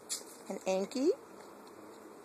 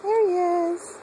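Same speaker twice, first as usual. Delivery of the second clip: excited greeting; 14 semitones higher, 9 dB louder than usual. Real, not acted.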